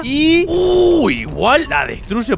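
high, loud drawn-out "uh"; awed exclamation. Real, not acted. Player watching the moon hit the Earth, awed. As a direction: let out a long, soaring "uh" at the impact.

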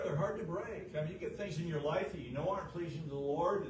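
ordinary delivery

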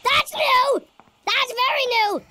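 high, loud startled exclaim; spooked, blurting it out; alarmed. Real, not acted. Sudden new sound.

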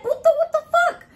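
very high, loud retold shriek; outraged yell. Woman retelling yelling at the cat, high outraged shriek.

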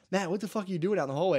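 high, fast mock telling-off; scolding coach. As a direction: play the coach catching a player out. Acts out coach scolding him.

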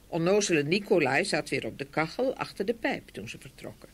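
usual speaking voice

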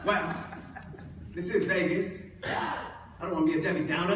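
hammed-up Australian accent; loud put-on Australian twang. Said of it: "I'll be speaking in this awful Australian accent".

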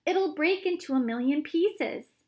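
panicked warning; frightened cry. A narrator's reading. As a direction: fear of shattering glass. Grandpa Joe panicking.

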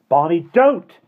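high, loud warning yell; shout. A narrator's reading. Simon shouts to stop Barney, alarmed. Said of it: "Simon shouted".

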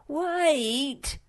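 high, loud frantic scream. A narrator's reading. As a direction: shriek after the one running off. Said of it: "screamed Sniff".

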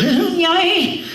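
high mock old-lady wail; hammed-up squealed "ay"; old woman wailing. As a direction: wail like a put-on granny. Old woman being groped.

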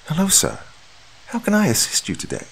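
calm, reassuring receptionist. A narrator's reading. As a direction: soothe a nervous patient at the desk. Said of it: "in a calm, reassuring tone".

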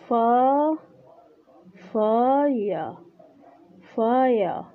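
"فا" alone, then "فايا" with the glide drawn out; teacher building "فايا" piece by piece, with pauses; triphthong demonstration. Shown as a demonstration. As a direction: sound out "فا", then join it slowly into "فايا". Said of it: "هذا تريفثونج".

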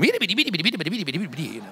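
rapid-fire babbled nonsense syllables; mimicked nonstop chatter. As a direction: imitate someone yapping without a breath. Imitates wife's nonstop football-game chatter.

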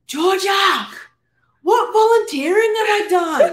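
high-pitched loud whiny plea; high whiny imitation. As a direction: mimic a helpless roommate, high and loud. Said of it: "she would be like".

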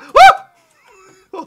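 loud, very high whoop with a laugh; excited whoop. Real, not acted. Gamer cheering mid fight, loud high whoop. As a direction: let out a wild cheer.

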